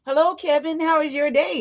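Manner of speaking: bright, high greeting to a stranger; cheery greeting. Retold or imitated. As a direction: greet him sunny and high.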